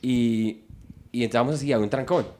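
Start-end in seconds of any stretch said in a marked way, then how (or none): none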